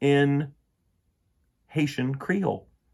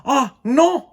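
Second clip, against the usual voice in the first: high, loud dismayed cry; a loud, high cry of despair; 11 dB louder than usual. Acted. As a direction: wail it in shock at the ashes. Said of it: "he cried".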